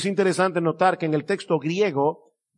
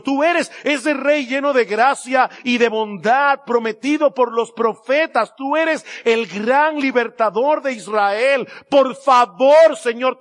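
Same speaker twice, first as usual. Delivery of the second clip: begging plea; 3 semitones higher than usual. Retold or imitated. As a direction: beg the Lord for mercy with rising urgency. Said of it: "apelando a la gracia y la misericordia".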